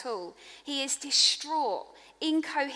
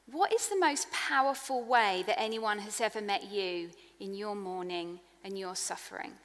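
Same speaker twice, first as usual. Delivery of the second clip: ordinary talk, nothing marked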